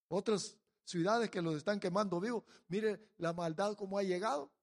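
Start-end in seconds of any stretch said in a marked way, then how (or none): none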